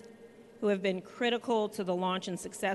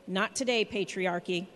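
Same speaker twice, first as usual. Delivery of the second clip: rally speech. Real, not acted. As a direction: rouse the crowd, punch each line. Rally cry ending a speech.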